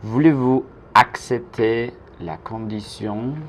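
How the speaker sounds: slow French "Voulez-vous accepter la condition?", word group by word group; slow model. Shown as a demonstration. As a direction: model it slowly for learners to repeat. Teacher's slow model sentence.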